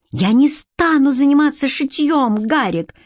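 high, tense angry refusal; infuriated refusal. Acted. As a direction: refuse furiously, holding back a shout. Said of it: "разъярённо процедила она".

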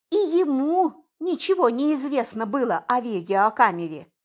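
ordinary delivery

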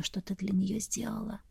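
breathy whisper like wind; gusting windy whisper. A narrator's reading. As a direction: whisper it like a breeze. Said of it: "будто ветерок шелестел". (шёпот, как шелест ветра)

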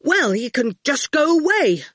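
put-on a boy trying to sound tough and failing; half-hearted bravado; mild attempt at fierceness; not fierce enough. A narrator's reading. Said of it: "It didn't come out as fierce as he had hoped".

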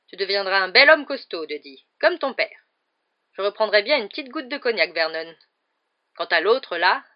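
loud, boorish aunt; tante Marge. A narrator's reading. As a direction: voice a self-satisfied guest holding forth loudly. Narrator voices aunt Marge. (tante sans gêne, voix forte)

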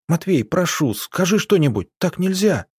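high-pitched, imploring appeal; urgent pleading. A narrator's reading. Arina begging Matvey to intervene, pleading. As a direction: beg for help, pitch climbing to the end.

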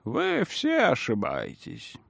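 put-on slow, soft old man's voice; indifferent old man. A narrator's reading. Old man correcting all the guests, slow and indifferent. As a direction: contradict them slowly, as an aged man. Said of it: "равнодушно произнёс старик".

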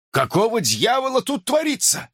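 high-pitched, imploring question; pleading. A narrator's reading. Father finding his son caged, pleading. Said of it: "В голосе слышалась мольба".

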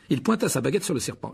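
usual speaking voice

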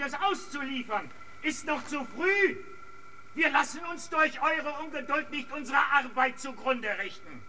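calling out. Acted. Addressing besiegers from afar.

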